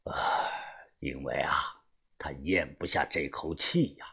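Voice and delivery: put-on low, hushed old boss voice; indifferent, understated. A narrator's reading. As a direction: say it quietly and coolly, deep in the throat. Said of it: "把头淡淡的说道". (淡淡地低声说)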